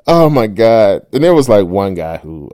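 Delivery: ordinary delivery, nothing marked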